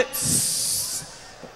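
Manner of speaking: a long unvoiced psst, slow; silent fart hiss. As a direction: hiss out a sneaky fart noise.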